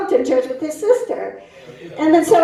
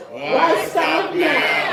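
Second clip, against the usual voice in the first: drawn-out line for the crowd to echo; slow call-and-response; 3 semitones higher, much slower than usual. Shown as a demonstration. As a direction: lead the audience slowly. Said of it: "I want you to say".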